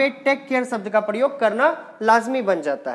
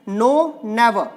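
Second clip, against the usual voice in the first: slow model; much slower than usual. Shown as a demonstration. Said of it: "इसको never पढ़ना है".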